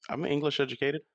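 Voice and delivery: mock offended. Guest teasing host over English education jibe, mock offended. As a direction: take playful offence, pitch jumping up. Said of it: "Why are you getting offended".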